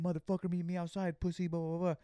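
high-pitched mock trash talk; talking shit. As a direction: imitate someone mouthing off. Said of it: "He just was kinda talking shit".

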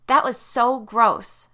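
high, loud, drawn-out disgust; grossed out. A narrator's reading. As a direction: groan it slowly, grossed out. Disgust after a monster movie.

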